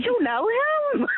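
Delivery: retold surprise. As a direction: ask it slowly, amazed he knows him. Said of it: "I said".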